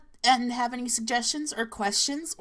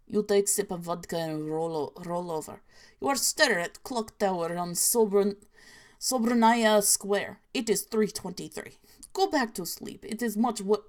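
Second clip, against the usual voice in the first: put-on inaccurate Russian accent. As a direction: voice the weary father in a hammed-up Russian accent. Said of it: "inaccurate Russian accent".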